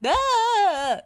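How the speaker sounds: high, loud shriek of fright; acted storybook scream; frightened scream. A narrator's reading. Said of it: "cried Lofty".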